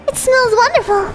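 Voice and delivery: put-on high sweet girlish voice; bright, breathy young girl; high cute anime girl. Voiced female character.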